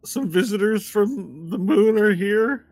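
high-pitched worried messenger; flustered Walnut. Acted. Asking the summoner for help with visitors, high and flustered. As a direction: report trouble in a high, flustered voice.

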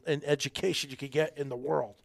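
ordinary talk, nothing marked

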